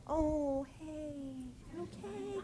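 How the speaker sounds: high, soft, pitying "you okay"; pitying concern. Retold or imitated. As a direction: coo it with fake sympathy, tilting the voice up. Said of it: "the ones who are like".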